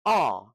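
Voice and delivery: "ah" held long, as in car; long A sound. Shown as a demonstration. Teacher showing the long A, "ah" as in car. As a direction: say "ah" as an open, drawn-out vowel. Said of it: "You have the long A".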